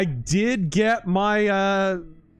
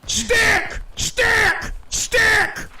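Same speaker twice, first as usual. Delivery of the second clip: high, loud urgent shouts; shouted callout; 4 dB louder, much slower than usual. Real, not acted. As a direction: yell the warning to your partner. Calling out sticky bomb.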